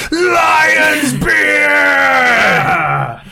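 long, high, full-force shout; battle cry. Acted. Game master shouting a warrior's victory cry, roaring. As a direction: bellow it like a war cry. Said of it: "and just shout".

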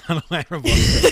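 laughing through the words; can't stop laughing. Real, not acted.

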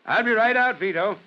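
high-pitched call to someone outside. Acted. Chief to his driver waiting outside, calling out.

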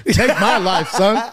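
loud, high, through laughter; laughing mock defiance; laughing bravado. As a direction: crack up while replaying the defiant line. Repeats his bravado line while laughing.

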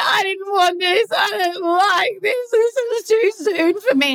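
high, slow tearful wail; exaggerated sobbing protest, words stretched out; mock crying. Re-enacting moving-day tears over the river house, mock crying. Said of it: "I'm, like, crying, crying, crying".

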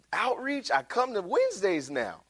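high, incredulous mock protest; making excuses. Believer protesting to God about outreach, high-pitched excuse-making. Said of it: "The excuses".

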